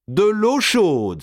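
high, drawn-out mocking cry; scornful exclamation. A narrator's reading. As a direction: echo the plea in loud derision. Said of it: "s'écria Gournay". (exclamation moqueuse, étirée)

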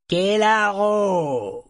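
very high, loud, slow bellow; a huge serpent bellowing its name. A narrator's reading. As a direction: roar the name to the circle. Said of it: "brailla-t-il".

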